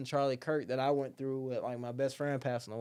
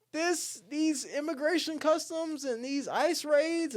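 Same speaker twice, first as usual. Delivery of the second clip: much higher, slow whiny neighbor voice; mocking neighbor; 13 semitones higher, slower than usual. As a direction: parody an outraged Nextdoor poster, high and drawn out. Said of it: "people went on Nextdoor".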